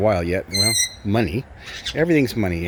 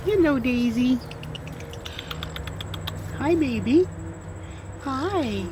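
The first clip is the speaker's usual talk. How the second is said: pet talk; slower than usual. Real, not acted. Greeting the bird Daisy.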